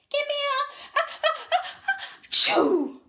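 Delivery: high, loud stuttering build into a sneeze. A narrator's reading. Cheerleaders sneezing mid-chant, high and loud. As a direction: let the sneeze build and burst. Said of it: "sneezed the cheerleaders".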